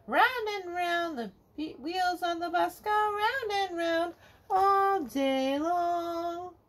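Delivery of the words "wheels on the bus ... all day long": plain reading voice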